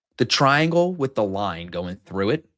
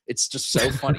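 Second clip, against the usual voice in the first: ordinary talk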